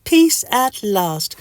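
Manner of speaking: high, slow contented squeak; Mister Itch. Acted. Mister Itch lies in the sunshine, high slow contentment. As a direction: draw it out high and restful. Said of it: "says Mister Itch".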